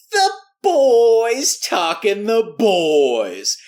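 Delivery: very slow, high and loud; hammed-up trailer announcer; announcer title. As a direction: boom it like a show title.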